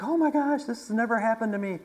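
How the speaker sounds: high, mock-tearful woman's voice; imitated bawling. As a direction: imitate her overwhelmed, weepy reply. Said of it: "she's bawling, and she's".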